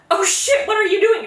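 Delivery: high, loud panicked yell; mother catching the nursemaid with her baby in the fire, shrieking; freaked-out shriek. Retold or imitated. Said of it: "freaks out".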